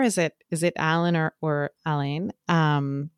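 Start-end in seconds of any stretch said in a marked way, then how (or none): 0.8-1.2 "Alan" as AL-un; first-syllable stress. Shown as a demonstration. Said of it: "being pronounced as Alan". 1.9-2.3 "Alain" as uh-LANE; second-syllable stress. Shown as a demonstration. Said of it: "he pronounces her detective as".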